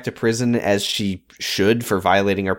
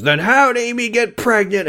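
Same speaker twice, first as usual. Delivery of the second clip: pitched way up, accusing; mock accusation; 10 semitones higher than usual. [name] snapping at Ben, high mock accusation. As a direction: throw the question back high.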